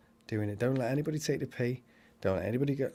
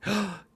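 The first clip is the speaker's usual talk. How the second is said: excited gasp; 10 semitones higher than usual. Real, not acted. Excited about holiday.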